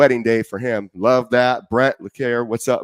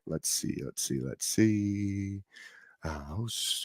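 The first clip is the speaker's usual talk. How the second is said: under his breath, almost whispered, slow; quiet off-mic mutter to himself; 13 dB quieter, slower than usual. Real, not acted. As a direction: mumble it aside while busy setting up. Host muttering while setting up.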